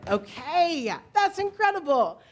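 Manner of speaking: excited praise. Real, not acted. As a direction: cheer the singers on, thrilled. Praises group after singing.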